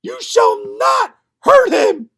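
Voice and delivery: high, loud protective shout; defiant shout. Acted. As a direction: shout down the Mouse King. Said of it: "cried Marie".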